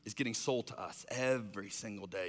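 normal talking voice

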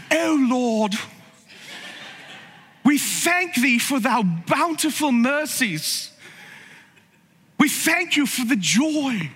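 pious prayer in a put-on English accent, pitched high; hammed-up spiritual English accent, high and slow; mock English accent. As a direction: pray grandly in an imitated English accent, drawing out the words. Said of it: "like, an English accent".

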